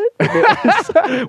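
a high burst of real laughter. Real, not acted. Laughing hard at the mom's reaction, high and real.

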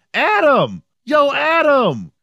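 high, drawn-out call of a name; imitated yelling. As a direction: mimic a woman hollering to get someone's attention. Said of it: "I hear this woman yelling the name Adam".

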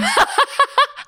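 bursts into a high, loud laugh; real laugh, high and bright; a delighted laugh breaking out. Real, not acted. Listener laughs at the story.

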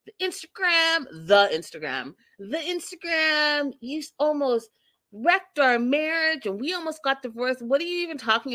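hammed-up outrage; mock freak-out. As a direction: mimic her indignant fit. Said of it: "that's when Angela freaks out".

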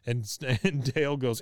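normal talking voice

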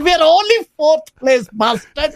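high, lilting Indian accent impression; playful CEO impression with Indian accent. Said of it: "he wants me to do an Indian accent".

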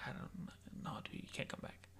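very soft, half-voiced refusal; mumbled, reluctant-sounding rejection; quiet brush-off. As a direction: turn them down almost in a whisper. Acted rejection of a former friend.

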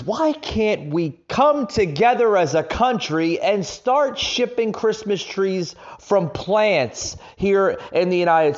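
high, loud and heated; fired-up ranting, pushing hard; angry rant. Real, not acted.

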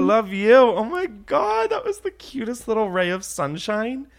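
high, slow, gushing awe. Real, not acted. Gushing to cohosts about the nervous caller, high. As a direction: melt over the caller's sweetness.

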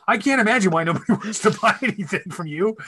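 high, sarcastic retold rebuke with a laugh in it; exasperated scolding. Son to dad after he insults a customer, exasperated.

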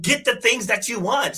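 imitating Thai woman. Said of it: "she was like".

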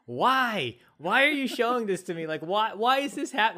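loud high bewildered why; confused yelling. Retold or imitated. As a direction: yell at the screen in confusion. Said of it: "they're just gonna be like".

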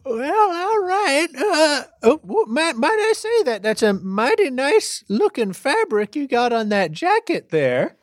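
high folksy drawling judge; character voice. Acted. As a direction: play a chatty frontier judge.

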